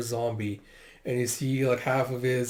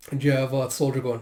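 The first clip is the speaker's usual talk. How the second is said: ordinary delivery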